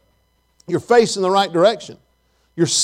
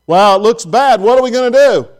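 louder, higher mock worried cry; mock alarm; 3 semitones higher, 6 dB louder than usual. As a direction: imitate people fretting out loud. Mimics what most people say.

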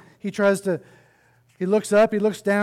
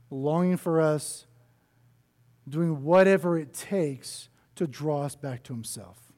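earnest preaching; 3 semitones lower than usual. Real, not acted. Sermon point delivered.

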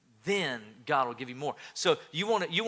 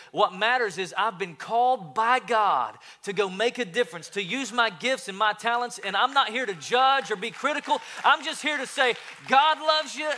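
rising, louder preacher's call, higher and higher; full-force preaching; 5 semitones higher, 5 dB louder than usual. Acted. Pastor preaching to his congregation, ringing and loud. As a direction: preach it out to the back row.

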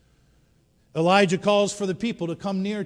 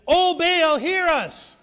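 high, loud desperate cry to a god; frantic crying out; 12 semitones higher, 7 dB louder, much slower than usual. Acted. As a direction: beg the idol at the top of your voice. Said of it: "this is what they said".

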